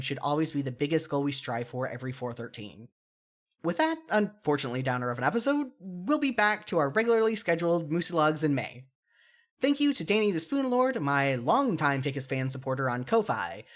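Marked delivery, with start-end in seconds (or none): none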